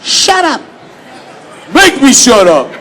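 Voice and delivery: loud, high shouted dare; mock shout. Teasing a stranger with a loud shout. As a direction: yell it to pick a fight. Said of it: "I said to the man".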